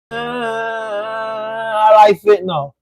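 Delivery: slow, drawn-out mocking parody of the troll. Parroting the troll back at him in a slow mocking drawl. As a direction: drag it out like you're making fun of him. Mimicking the troll's own words.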